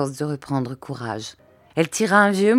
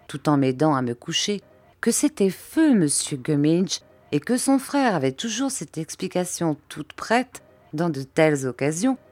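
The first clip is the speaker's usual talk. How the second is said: ordinary delivery, nothing marked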